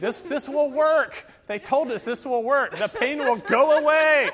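loud, high, insistent mock pleading; hammed-up frantic husband coaching through labor; retold desperate coaching. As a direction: build to a comic shout of reassurance. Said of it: "And I'm going".

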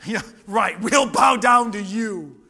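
loud, sarcastic scoffing; scornful brothers. Acted. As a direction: jeer at the little brother's big dream. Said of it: "what was their response?".

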